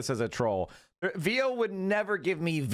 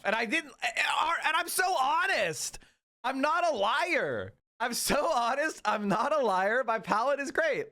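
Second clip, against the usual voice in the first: indignant protest; 7 semitones higher than usual. Real, not acted. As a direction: plead your honesty, stretched out and high. Insisting to chat she's honest.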